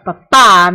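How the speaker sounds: "Papá" slow and loud, stress on the final "á"; accented papá. Shown as a demonstration. Teacher spelling out the meaning for students, slow and loud "Papá" with final stress. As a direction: lean into the last syllable of "Papá". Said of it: "there should be an accent on the ah".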